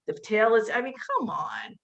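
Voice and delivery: ordinary talk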